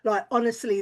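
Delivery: ordinary talk, nothing marked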